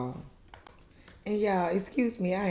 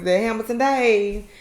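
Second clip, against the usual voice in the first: louder, higher imitation of a friend; Letitia impression; 5 semitones higher, 6 dB louder, slower than usual. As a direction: put on her voice, louder and raised. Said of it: "In my Letitia voice".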